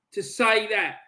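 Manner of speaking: ordinary delivery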